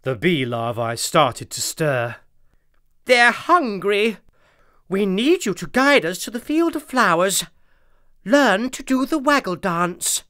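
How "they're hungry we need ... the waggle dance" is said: urgent queen bee. A narrator's reading. Queen urging Daisy.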